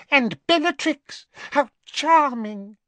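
very high, thin squeak; squeaky voice. A narrator's reading. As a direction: squeak the name like a rat. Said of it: "he said in a squeaky voice".

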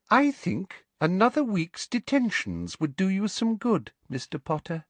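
high, silky menace; sleek. A narrator's reading. Teacher hands detention to defiant pupil, sleek. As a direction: sweetly pronounce the punishment. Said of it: "said Umbridge sleekly".

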